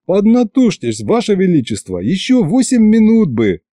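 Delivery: high-pitched eager pleading; pressing, excited appeal; urgent encouragement. A narrator's reading. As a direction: spur the ruler on. Urging the straining tsar on.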